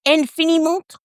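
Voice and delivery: Infiniment pitched high in a stab at French; attempted French. Shown as a demonstration. Attempting a high French Infiniment for viewers. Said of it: "I know I can't pronounce".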